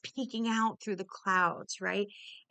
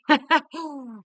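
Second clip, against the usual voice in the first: loud delighted laugh, high and bright; burst of laughter; 7 semitones higher, 9 dB louder than usual. Real, not acted. Runner laughing at her own love of falling. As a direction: laugh out loud with real glee.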